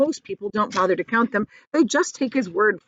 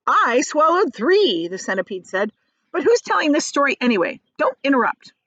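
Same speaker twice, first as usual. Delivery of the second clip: boastful centipede; 6 semitones higher, 6 dB louder than usual. A narrator's reading. Boastful centipede, loud high.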